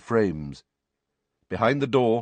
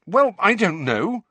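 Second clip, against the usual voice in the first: angry, slow, louder and higher; 12 semitones higher, 7 dB louder than usual. Acted. Harry answers the headmaster, rather angrily. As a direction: snap it out, fed up with the question. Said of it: "said Harry rather angrily".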